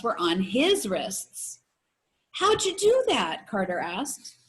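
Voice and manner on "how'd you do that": high, amazed question; surprised. Acted. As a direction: gasp it out in disbelief. Carter amazed by the trick.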